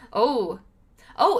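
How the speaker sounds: normal talking voice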